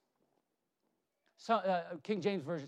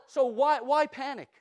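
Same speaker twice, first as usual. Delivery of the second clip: usual speaking voice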